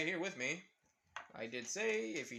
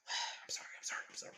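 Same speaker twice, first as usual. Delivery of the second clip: breathless whispered sorrys; whispered apology; 4 dB quieter, much faster than usual. Retold or imitated. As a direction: whisper them in a cowering rush. Naruto begging, now whispered.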